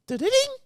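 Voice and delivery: high sing-song jingle of a TV switching on; sung chime sound. Retold or imitated. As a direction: chirp it like a startup tune. Imitating TV startup chime.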